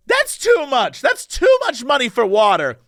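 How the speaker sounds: high mock-outraged complaint; protesting drunk woman. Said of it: "And then she goes".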